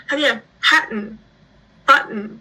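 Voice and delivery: "pattern" and "button" slow, with the T swallowed; dropped T. Shown as a demonstration. Explaining the Australian T to the interviewer, slow and clear. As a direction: say "pattern", "button" slowly, no T sound. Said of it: "不念那个T的音".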